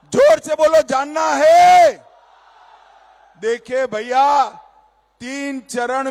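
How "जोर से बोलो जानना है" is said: high loud crowd call; shouting. Real, not acted. Rally crowd call and response.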